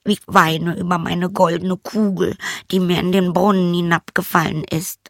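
put-on a disdainful young princess calling the frog. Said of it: "sagte sie".

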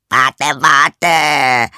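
high, loud croaky cry; frog calling out. A narrator's reading. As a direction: call after someone as a storybook frog. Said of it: "rief der Frosch".

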